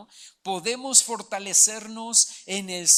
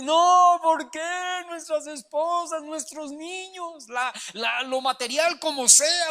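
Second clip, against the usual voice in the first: high, raised voice, crying out over lost families; a hammed-up grieving cry, higher and louder; anguished lament; 6 semitones higher, 5 dB louder than usual. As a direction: wail it like grieving soldiers. Weeping men's lament, acted out.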